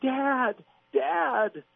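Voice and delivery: put-on high, pleading child's call; imitating a desperate child calling dad; hurt child crying out. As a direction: call out like a kid who just fell off a bike. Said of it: "the kind of voice that".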